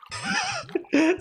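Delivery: very high cartoon sponge laugh; cartoon sponge cackle, far above normal pitch; SpongeBob laugh. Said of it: "do your best SpongeBob impression".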